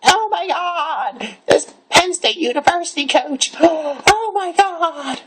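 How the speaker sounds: very high, slow shocked voice; outraged gasping gossip. Retold or imitated. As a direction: squeal it out in mock outrage.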